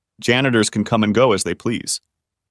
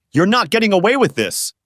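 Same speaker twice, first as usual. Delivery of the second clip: higher, mock angry spit; spat out angrily; 10 semitones higher than usual. A narrator's reading. Angry judge warning the inmate, spat out in a higher voice. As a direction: judge spitting threats at the prisoner, higher and hammed-up. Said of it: "he spat".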